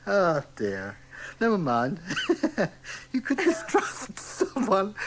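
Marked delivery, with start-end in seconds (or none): none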